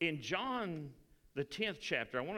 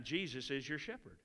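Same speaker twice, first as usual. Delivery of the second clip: ordinary delivery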